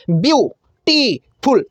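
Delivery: Beautiful slowly in three separate beats, a stop after each; syllable by syllable. Shown as a demonstration. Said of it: "तीन बार में". (Beautiful को तीन बार रुककर बहुत धीरे बोलिए)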